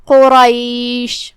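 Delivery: قریش read with tafkheem; heavy qaf and ra. Shown as a demonstration. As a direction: give قریش a thick throaty qaf and heavy ra. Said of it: "قاف کو اور را کو دونوں کو بھاری آواز میں پڑھیں گے".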